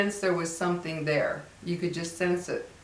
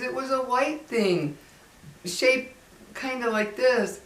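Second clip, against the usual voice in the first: put-on higher, slow frightened child's voice; scared little boy; 6 semitones higher than usual. Retelling the boy at the skylight, higher and slow. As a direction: play the spooked kid pointing up. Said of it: "And he says".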